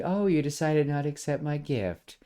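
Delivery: snide comment. Retold or imitated. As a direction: needle him with fake sweetness. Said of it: "a snide comment".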